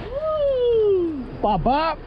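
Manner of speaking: ordinary talk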